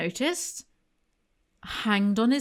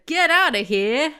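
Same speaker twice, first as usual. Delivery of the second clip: mock farmer yell, raised and loud; farmer ordering out; 5 semitones higher, 6 dB louder than usual. A narrator's reading. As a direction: call it out as a storybook farmer. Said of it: "The farmer saw the duck and said".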